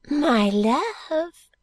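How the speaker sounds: put-on high, slow, whispery old lady confiding; fluttery elderly lady, hushed and high; Miss Flite voice.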